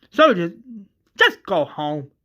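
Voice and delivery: heated send-off; angry dismissal. Acted. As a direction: order someone out in anger. Madison sends Strand away angrily.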